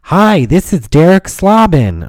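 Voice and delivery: loud, high, drawn-out greeting; altered caller voice. Retold or imitated. Caller greeting the next person, loud high silly voice. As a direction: put on a slow, high-pitched caller. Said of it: "constantly alter my voice".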